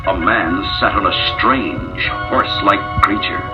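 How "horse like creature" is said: ordinary delivery, nothing marked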